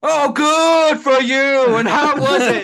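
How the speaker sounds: high, loud hammed-up rant; furious mock tirade, dragged out and shouted. Imitating the actor's on-set outburst, mock raging.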